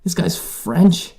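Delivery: he hears the scary pirate speak French, high disbelieving squeal; incredulous. Retold or imitated. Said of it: "I was like".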